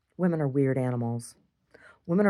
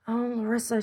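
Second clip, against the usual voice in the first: high-pitched quote of an admirer; imitated admiring woman; 8 semitones higher than usual. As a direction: lightly mimic her in a raised pitch. Retells the woman's admiring talk.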